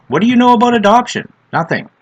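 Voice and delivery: high, fast scoffing question; mocking challenge. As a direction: scoff at her right away. Mocking the lady's claim.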